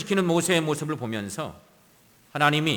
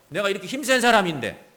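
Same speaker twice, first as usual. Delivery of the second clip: higher, louder mock indignant boast; imitating an angry show-off; showing off in anger; 6 semitones higher, 5 dB louder than usual. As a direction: act out puffed-up, offended pride. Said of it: "그러면서 나를 과시하는 거죠".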